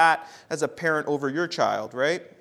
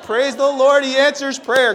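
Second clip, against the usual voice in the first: loud, high burst of praise; joyful praise; 7 semitones higher, 7 dB louder than usual. Retold or imitated. Pastor retelling his joy at the speeding driver pulled over. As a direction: shout it out with delight. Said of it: "I said".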